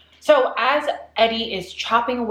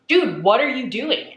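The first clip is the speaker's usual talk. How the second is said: alarmed; 6 semitones higher, 5 dB louder than usual. Retold or imitated. Friend hacking at tree.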